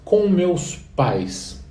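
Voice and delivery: "Com meus pais" said very slowly, word by word; slow, careful phrase for students; slow, stressed model phrase. Shown as a demonstration. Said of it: "como é que eu digo em inglês".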